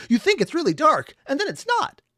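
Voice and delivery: high, loud sing-song mock of the video's joke. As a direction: parody the joke with an exaggerated rising voice. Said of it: "The entire internal joke of this video was".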